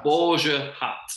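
Borgia hat said slowly, each word drawn out and clear; slow careful repeat. Shown as a demonstration. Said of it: "repeats the word slowly".

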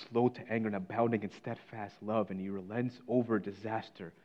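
gentle invitation. Retold or imitated. God calling sinners home through the preacher, soft and tender. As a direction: invite them back tenderly.

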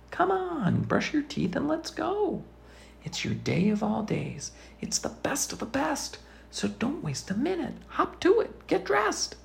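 a hint of an eager birthday bird hurrying you. A narrator's reading. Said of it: "Then the bird says".

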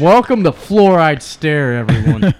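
loud, high, drawn-out announcer greeting; mock show welcome. Host welcoming everyone after his friend's gross moment, mock show welcome. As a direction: belt out a slow, joking welcome to the audience.

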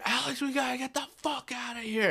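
imitated high-pitched cry of alarm; panicked yell. Retells friend's panicked yell in the waves.

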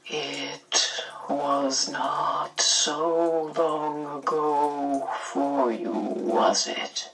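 very slow, soft and tired; gently. A narrator's reading. Missus Which asks Missus Whatsit gently, very slow and soft. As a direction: fade almost to a whisper at the end. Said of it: "she asked gently".

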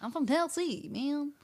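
hammed-up American South accent; mock Tennessee accent. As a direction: joke in a Tennessee twang. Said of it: "She's from, like, Tennessee".

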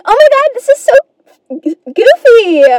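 put-on high, giggly cute-girl voice; hammed-up bubbly sweet girl; positive girl. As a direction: giggle it off in a girly pitch. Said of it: "a positive girl".

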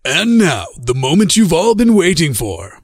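booming trailer announcer intro; big dramatic announcer build-up. Shown as a demonstration. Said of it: "I can't really do that announcer voice".

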